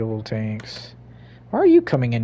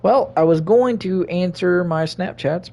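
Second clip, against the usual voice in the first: normal talking voice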